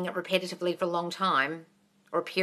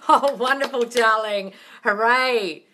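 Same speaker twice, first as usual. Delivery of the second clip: louder, laughing gush; laughing praise; 2 semitones higher, 5 dB louder, much slower than usual. Real, not acted. Laughing praise for a viewer who switched thoughts. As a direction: laugh it out with delight.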